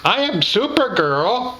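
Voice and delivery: a hint of a playful child's voice, lilting up; playful little girl. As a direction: imitate a little girl playing superhero. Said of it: "in the most playful little girl voice".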